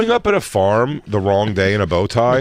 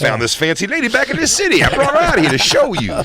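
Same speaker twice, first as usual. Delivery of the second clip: high mock salesman; 11 semitones higher than usual. Playing salesman showing wife.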